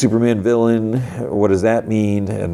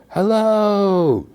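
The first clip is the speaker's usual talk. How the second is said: mock yell; 13 semitones higher than usual. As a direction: imitate calling into empty distance. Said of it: "he just yells out".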